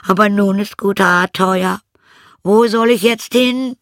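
put-on high, loud lament of an old cat; complaining cat character. Old cat tells how it fled, complaining cat voice. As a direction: pour out the woes high and strong.

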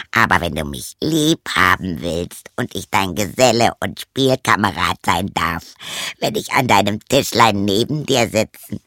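a sly frog making his deal. Acted. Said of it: "Der Frosch antwortete".

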